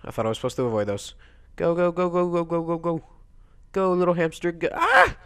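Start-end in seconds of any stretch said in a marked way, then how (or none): 1.6-4.7 high, chanted cheering; rapid-fire "go" in a raised pitch; urging on. Real, not acted. Urging game hamster along. 4.7-5.2 very high, loud shriek; sudden piercing yelp; startled squeal. Real, not acted. Hamster fails mid-run.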